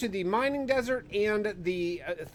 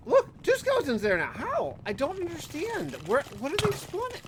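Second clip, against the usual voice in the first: climbs higher and higher in exasperation; rising disbelief; 9 semitones higher than usual. Real, not acted. Showing viewers two skeletons, building higher disbelief. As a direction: bewilderment building to a peak.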